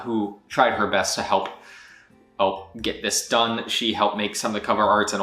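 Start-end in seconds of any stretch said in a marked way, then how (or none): none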